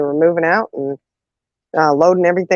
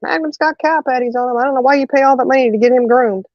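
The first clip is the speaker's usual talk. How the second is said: put-on complaining kid; 7 semitones higher than usual. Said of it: "I was like".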